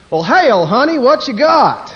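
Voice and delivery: hammed-up Texas twang, loud and high; loud Texan. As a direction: bellow like a rowdy Texan. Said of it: "get to the Texan".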